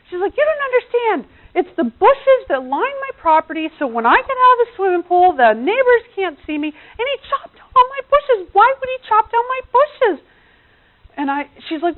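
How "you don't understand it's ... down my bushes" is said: high, loud, aggrieved complaining; imitating the upset friend, much higher and louder; indignant rant. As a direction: plead your outrage over the bushes. Friend keeps ranting about bushes.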